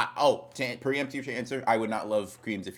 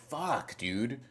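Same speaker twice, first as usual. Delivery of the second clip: normal talking voice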